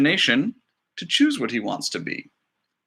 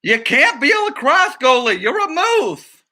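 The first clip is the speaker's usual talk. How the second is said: much higher, louder scolding; exasperated director; 11 semitones higher, 4 dB louder, slower than usual. Acted. The director telling grandma she can't be a goalie, exasperated. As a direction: insist she is a moose.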